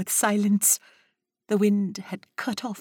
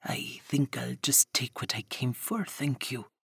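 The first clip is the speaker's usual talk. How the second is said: low, hushed menace; menacing stranger whispering his demand; sinister whisper; 6 semitones lower, 7 dB quieter than usual. Acted. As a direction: breathe the threat softly. Sinister Spinner claims his due.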